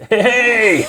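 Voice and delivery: high, loud laughing mock whoop; hammed-up old man cheering at a concert; old Scottish guy as a fan. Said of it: "just this really old Scottish guy".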